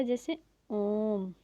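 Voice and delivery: ओम held long and slow as a three-beat pluta vowel; teacher draws out ओम to show a long vowel; drawn-out om. Shown as a demonstration. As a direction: stretch ओम out slowly. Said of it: "उच्चारण करने में समय लगता है ना".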